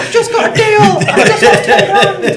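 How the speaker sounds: high, loud, laughing through it; laughing mock swings. To the hosts, laughing through mock highs and lows. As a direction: act out the wins and losses while laughing.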